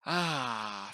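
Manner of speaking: ordinary delivery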